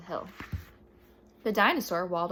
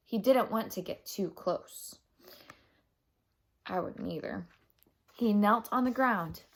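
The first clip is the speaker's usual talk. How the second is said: plain reading voice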